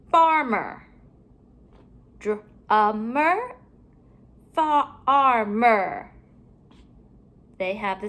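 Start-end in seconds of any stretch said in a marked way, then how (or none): 2.2-3.5 DRUMMER split before the ending, rising at the end; broken apart. Shown as a demonstration. Teacher comparing word endings for pupils, sounds pulled apart. 4.5-6.1 FARMER in stretched, separate sounds, falling at the end; broken apart. Shown as a demonstration. Teacher comparing word endings for pupils, sounds pulled apart.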